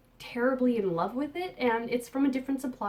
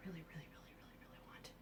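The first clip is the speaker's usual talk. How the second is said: whispering; 4 semitones lower, 23 dB quieter, much faster than usual. Real, not acted. Confiding yarn she wants.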